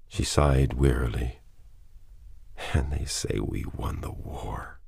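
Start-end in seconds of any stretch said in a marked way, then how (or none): 2.7-4.8 a tired, whispered aside; weary whisper. A narrator's reading. Sick wife to her husband, wearily whispering. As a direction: breathe it out, worn down and bitter.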